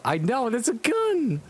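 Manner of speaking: ordinary delivery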